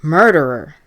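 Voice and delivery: loud, accusing scream. A narrator's reading. Ghostly voice turns on the old man, screamed accusation. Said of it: "the voice screamed".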